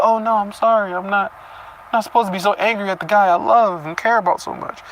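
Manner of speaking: mock her guilty gushing; mocking Cecilia. Reader mimicking Cecilia's guilty apology, light mocking voice. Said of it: "and then you're like".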